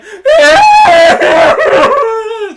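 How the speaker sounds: very loud, high imitated bawling; mock crying wail. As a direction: shriek out an exaggerated cry. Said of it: "just crying".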